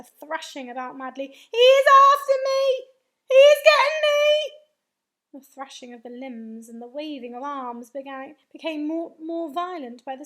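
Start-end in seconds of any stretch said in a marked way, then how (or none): 1.3-4.6 high, wailing scream of panic. A narrator's reading. Giant thrashing about madly, panicked scream. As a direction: scream it as if being chased. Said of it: "screamed the flesh lump eater".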